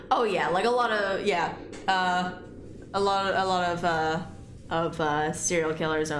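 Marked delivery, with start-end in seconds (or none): none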